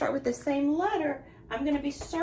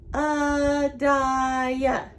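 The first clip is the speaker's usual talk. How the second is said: "Adiah" in three long, even, louder beats; split into syllables; 5 dB louder than usual. Shown as a demonstration. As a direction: draw out each syllable of "Adiah" on a level pitch. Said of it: "Three syllables".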